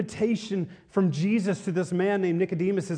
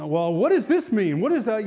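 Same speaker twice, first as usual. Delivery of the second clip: loud, higher-pitched questioning of a new believer; mock loud, oblivious questioning; imitating loud man; 5 semitones higher, 7 dB louder than usual. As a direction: blurt the questions out too loudly. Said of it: "he's just loud".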